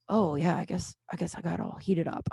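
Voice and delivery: low, soft sheepish mumble; sheepish backing down. Retold or imitated. Heated customer backing down to the bartender, sheepishly quiet. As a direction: imitate someone backing down quietly.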